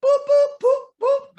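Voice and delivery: high, loud video-game boops; four punchy high-pitched boops like game targets popping up; imitated pop-up boops. Imitating Duck Hunt pop-ups.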